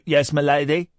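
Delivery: lightly voiced deferential servant; a quick, understated servant impression; Parker. Host quoting a puppet show's catchphrase, light impression. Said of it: "Now you're saying Parker as in".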